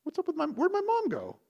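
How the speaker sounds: put-on a boy baffled by his dad's "my wife"; confused kid. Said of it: "I was like".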